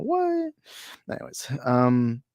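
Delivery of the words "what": squeaky, far higher mock-shocked question; incredulous high reaction. Real, not acted. Reacting to a chat comment.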